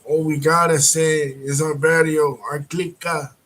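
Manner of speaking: put-on Chicano street accent. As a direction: Chicano gang member voicing his loyalty to the barrio in a Chicano accent. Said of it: "typical Chicano accent".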